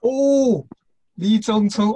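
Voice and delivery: ordinary talk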